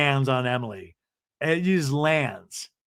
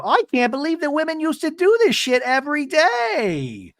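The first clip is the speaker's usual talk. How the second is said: much higher, exaggerated whine; imitating whiny Gina; 12 semitones higher than usual. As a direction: moan about chores theatrically. Gina voiced complaining.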